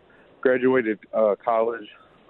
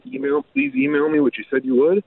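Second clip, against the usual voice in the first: pointed insistence; 12 semitones higher than usual. Retold or imitated. Pointed reply to dismissive lady.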